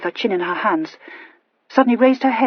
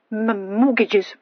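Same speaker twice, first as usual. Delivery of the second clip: slow, stammered single word; cryptically; 3 semitones higher, much slower than usual. A narrator's reading. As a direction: drop it like a dark hint. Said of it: "said Horatia cryptically".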